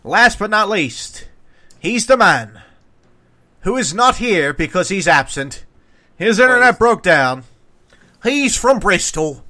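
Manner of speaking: punched phrases with suspenseful gaps; announcer introduction. Acted. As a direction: announce the absent guest with full fanfare. Announcer introduction of absent guest.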